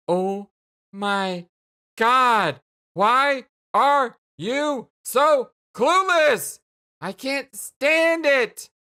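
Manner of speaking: slow, drawn-out angry wail rising high; exasperated outburst. Acted. Jane despairing at Jake's cluelessness, exasperated shout. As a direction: build from measured words to a loud cry.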